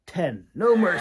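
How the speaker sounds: a mock tough-guy demand, loud; loud, mock no-mercy order; blunt loud quote. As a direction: punch it out loud and hard. Chris's blunt reply, quoted.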